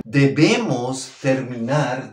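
ordinary talk

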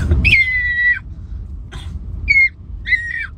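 very high whistle-register squeal; [name] whistle note. Shown as a demonstration. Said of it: "Pasample ng isang [name]".

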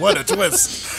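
squeaky high mock gasp; high twist voice. M. Night joke.

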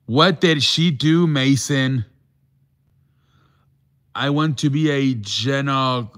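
ordinary delivery

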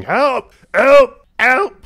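high, loud repeated cries for rescue; screaming for help. Acted. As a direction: yell it in short, desperate bursts. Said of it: "He's still screaming".

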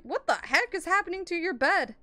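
shocked. Real, not acted. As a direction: react in shock at the sight. Shocked at friend's bed.

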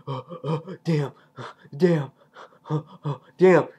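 puffed-out gasps between exclamations; acted running panting. Rick runs back past the zombies, mock breathless exclamations. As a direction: mimic huffing on the run. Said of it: "Rick just running back".

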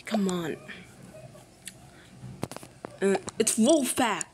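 ordinary talk, nothing marked

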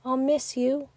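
soft, aching farewell; sad whisper. A narrator's reading. Bear cub missing his melted friend, soft and sad. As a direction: hush it with sorrow. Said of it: "whispered sadly".

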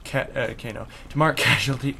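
ordinary talk

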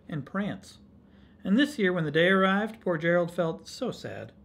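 lively, lilting rhymed storytelling; story read to children. A narrator's reading. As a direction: read it aloud to children with bouncy stresses.

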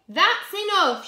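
loud, high storybook command; heroic shout. Acted. Super Potato confronting the pea, heroic shout. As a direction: shout it to halt the villain. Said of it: "shouted Sue Potato".

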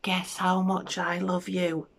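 put-on slow, soft, slightly lifted child-hare voice; little hare. Little hare asking the big hare, tender little voice. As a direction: ask it gently and slowly.

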